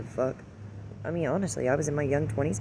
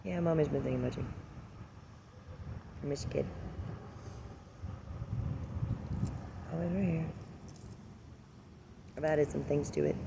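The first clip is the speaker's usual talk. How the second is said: tender and soft; 11 dB quieter than usual. Real, not acted. Speaking to deceased son.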